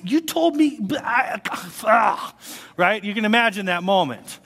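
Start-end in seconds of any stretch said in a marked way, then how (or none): 0.0-2.3 much higher, stammering and caught off guard; flustered Abraham. Retold or imitated. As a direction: sputter like a cornered husband. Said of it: "I can just imagine Abraham going".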